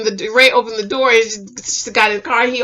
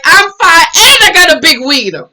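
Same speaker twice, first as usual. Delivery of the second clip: bragging; 6 semitones higher, 6 dB louder than usual. Retold or imitated. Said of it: "And he's like".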